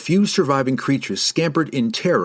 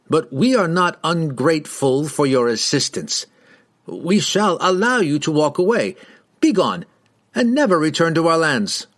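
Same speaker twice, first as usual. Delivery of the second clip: higher, imperious dismissal; haughty dismissal; 6 semitones higher than usual. A narrator's reading. Rider masking doubt dismisses strangers, haughty. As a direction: grant leave grandly, then order them off.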